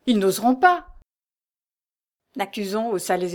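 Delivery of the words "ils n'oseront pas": plus fort et plus aigu, indigné; s'écrier. A narrator's reading. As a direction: refuser d'y croire, avec force. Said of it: "s'écria Raoul". (protestation indignée, voix haussée)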